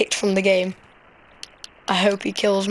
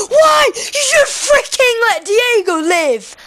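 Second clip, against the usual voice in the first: hammed-up crying plea, much higher and louder, slow; mock wail; 12 semitones higher, 5 dB louder, slower than usual. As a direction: pretend to sob over a fallen friend. Teammate Diego gets killed.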